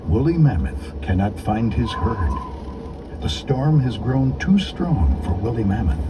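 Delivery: plain reading voice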